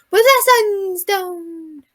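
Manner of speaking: high, very slow stretched-out voice; weird voice. Real, not acted. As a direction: stretch "Sunstone" out high and slowly. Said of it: "I don't know why I just did that weird".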